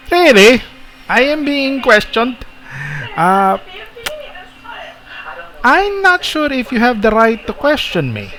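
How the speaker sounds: high-pitched, haughty character voice; affronted admiral. Acted. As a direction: pitch it high and take offence at being questioned. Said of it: "Sabi ni Admiral".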